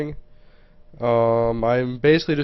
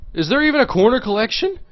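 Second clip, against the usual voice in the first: higher, louder frustrated question; exasperated outburst; 9 semitones higher, 6 dB louder, faster than usual. Real, not acted. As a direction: blurt it out, fed up. Frustrated searching cards.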